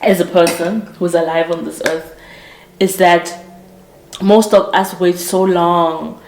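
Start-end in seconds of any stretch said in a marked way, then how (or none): none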